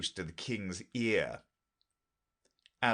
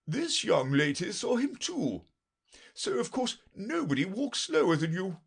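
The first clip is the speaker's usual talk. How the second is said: high, brisk-tempered king's voice; pompous king; 11 semitones higher, 4 dB louder than usual. Acted. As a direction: play a fussy king, pitched well up.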